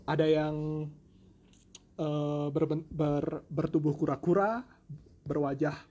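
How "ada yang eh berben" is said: ordinary delivery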